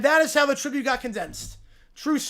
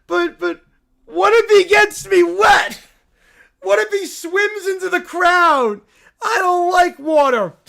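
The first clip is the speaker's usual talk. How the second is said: put-on higher, louder scared kid pleading; panicky whine; 5 semitones higher, 6 dB louder than usual. Lil Ron Bear panicking about getting wet, scared whine. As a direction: fret loudly like a frightened brat.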